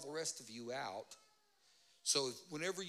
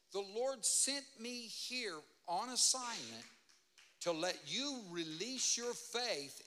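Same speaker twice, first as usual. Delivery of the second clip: slow, raised preaching declaration; preaching at full force; 6 semitones higher, slower than usual. Acted. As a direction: proclaim it slowly to the crowd.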